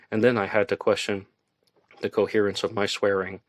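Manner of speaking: ordinary delivery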